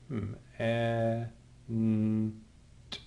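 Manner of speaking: a, n, t as separate soft letter sounds; a as in ant, then n, then t, each alone; sounding out. Shown as a demonstration. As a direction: softly sound out a, n, t with gaps.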